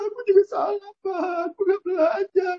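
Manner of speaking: put-on high, tearful child's voice, breaking into sobs; child crying. Young son crying as he apologizes to his father, sobbing. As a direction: sob it out like a small boy who admits his fault. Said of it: "langsung nangis".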